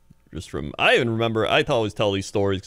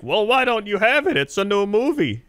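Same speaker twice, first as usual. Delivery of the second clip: high, insistent mock old lady; old woman; 10 semitones higher than usual. Old woman challenging the store clerk, high mock voice. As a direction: question it like an uncomprehending elderly customer. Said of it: "this old woman with Kung Fu Panda".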